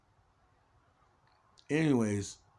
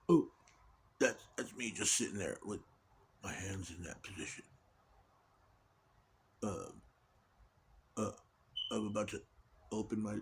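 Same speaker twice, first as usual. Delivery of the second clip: praying mantis; 4 semitones lower, 11 dB quieter than usual. Pretend. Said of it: "in his little praying mantis no-, voice".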